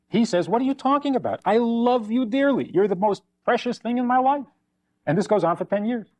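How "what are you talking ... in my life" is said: acted baffled protest, pitched high; husband's protest. Husband answers his wife's complaint, protesting his love. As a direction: protest your devotion in a raised pitch.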